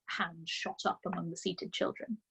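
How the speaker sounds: ordinary delivery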